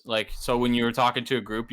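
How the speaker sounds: ordinary talk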